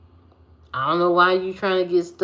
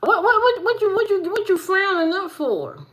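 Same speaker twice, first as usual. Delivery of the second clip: much higher, louder mock voice; teasing voice; 14 semitones higher, 3 dB louder than usual. Teasing a frowning friend in a much higher mock voice. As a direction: pitch it way up to tease.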